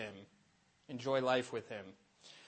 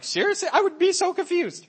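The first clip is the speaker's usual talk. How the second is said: incredulous disbelief; 8 dB louder than usual. Retold or imitated. A catechist mocking her own reaction to a confused visitor, high incredulous disbelief. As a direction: pitch jumps way up, louder, mock amazed. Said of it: "I'm like".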